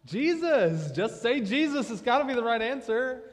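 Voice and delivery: loud, high, exuberant shout; exclaiming. Real, not acted. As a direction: blurt out the obvious answer at full voice. Answering own question after silence.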